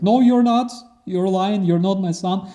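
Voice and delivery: high, insistent mother; mother rejecting her captured son, high and firm; denying mother. Retold or imitated. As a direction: refuse flatly to accept him.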